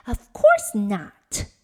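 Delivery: very loud, slow spoilt snap; rude snap. A narrator's reading. Spoilt Nona snapping at Father Frost, loud and rude. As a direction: bite it out like a spoilt brat. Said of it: "snapped Nona".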